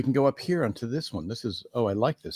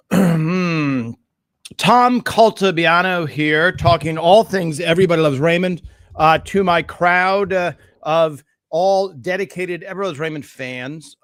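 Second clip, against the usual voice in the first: enthusiastic shouting; 3 semitones higher, 7 dB louder than usual. Real, not acted. Host greeting his dedicated Everybody Loves Raymond fans, shouting with enthusiasm. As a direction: project it loudly out of pure enthusiasm. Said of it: "I don't know why I'm shouting".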